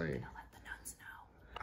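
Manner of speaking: very soft, slow whisper. Real, not acted. Tattooed visitor worried about nuns, whispering. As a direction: barely voice it. Hushed talk in spooky building.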